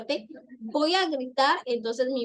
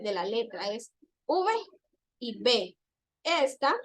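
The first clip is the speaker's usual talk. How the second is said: normal talking voice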